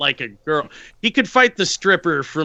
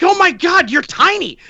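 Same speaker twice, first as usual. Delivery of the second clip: high, loud gushing cry; gushing over a tiny friend, high and loud; excited squeal; 11 semitones higher, 4 dB louder than usual. Retold or imitated. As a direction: squeal it in delight at how small he is. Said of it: "I was like".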